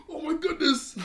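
big gasp, then very high, loud mock panic; hammed-up high-pitched shriek of alarm; freaking out. Everybody sees the reflection of Kuro, freaking out. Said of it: "starts freaking out".

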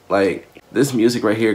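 normal talking voice

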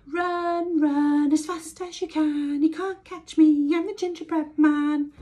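high, loud teasing chant; shouting taunt. A narrator's reading. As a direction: shout the taunt over your shoulder. Gingerbread man taunting again.

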